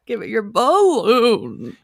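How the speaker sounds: BALLOON drawn out in mock indecision; imitated indecisive emphasis. Co-host teasing the host's title reading, imitated indecision. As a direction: slowly stretch BALLOON, copying an unsure ending. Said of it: "the way you said that".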